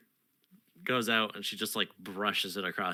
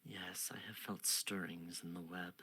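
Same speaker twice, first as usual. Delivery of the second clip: soft unvoiced whisper; 4 semitones lower, 12 dB quieter than usual. Queen answers the knight in a whisper. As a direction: whisper it like a secret.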